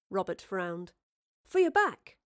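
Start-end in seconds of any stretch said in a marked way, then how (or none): 1.4-2.2 put-on boyish quip. A narrator's reading. Boy offers helpful joke.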